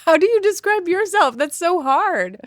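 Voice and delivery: high, loud protest; loud, stumped exclamation; exasperated outburst. Real, not acted. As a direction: throw the question back, loud and high. Stumped by question, protests loudly.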